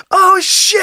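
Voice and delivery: put-on very high, squealed child voice; cute little kid. Dad imitating his son's cute swear, very high little voice. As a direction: squeal it like a startled tot. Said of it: "it's the cutest little just".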